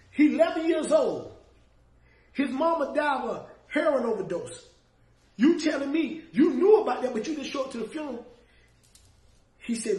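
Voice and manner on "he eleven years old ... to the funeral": louder and higher, pressing the accusation; heated retold reproach; retold indignation. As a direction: lay into him, incredulous. Confronting him about the funeral.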